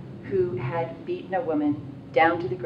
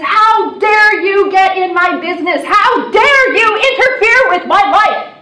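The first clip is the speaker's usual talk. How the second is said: loud, high, furious mock tirade; outraged how dare you; 13 semitones higher, 15 dB louder than usual. A beaten woman rounds on her rescuer in fury. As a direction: hurl it back at her in outrage. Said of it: "she turns around and she says".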